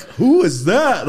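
high, loud and drawn-out; mock outburst. Comic contrasting a humble man with a rude reaction, mock outburst. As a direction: blurt it out slowly, high and big. Acting out the reaction he didn't get.

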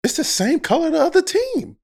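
squeaky-high disbelief at the complaint; incredulous protest. Real, not acted. Player protesting to host about shooting shirts, incredulous.

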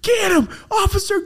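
high gleeful mock shout; mock cheering on the cop. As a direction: yell it like egging on a fight.

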